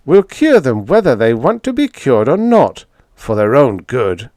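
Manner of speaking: a hint of a smug young fanatic lecturing; Price, zealous young demagogue. Price declares his plan.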